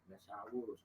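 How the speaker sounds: ordinary delivery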